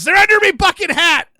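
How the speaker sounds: high, loud hammed-up pirate shout; loud hammed-up pirate punchline. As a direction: bellow it like a cartoon pirate. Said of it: "And he says".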